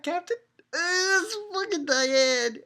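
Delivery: very high, drawn-out mock wail; hammed-up cry. Reader announcing Diane's return on next page, hammed-up squeal. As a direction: squeal the big arrival in exaggerated excitement.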